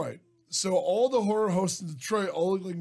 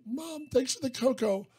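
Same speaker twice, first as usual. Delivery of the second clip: put-on high, soft little-kid line reading; child in a commercial; 6 semitones higher, 5 dB quieter than usual. Kid thanking mom in a cocoa commercial audition, sweet child voice.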